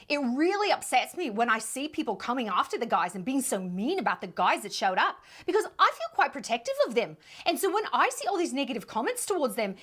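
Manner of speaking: put-on reading her statement in an Australian accent; put-on Aussie accent, lively lilt. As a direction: imitate an Australian woman defending herself. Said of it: "let me get the accent".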